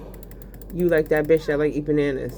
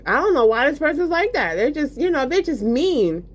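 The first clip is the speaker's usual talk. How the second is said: mock innocent victim; 8 semitones higher than usual. Mocking victim's fake innocence.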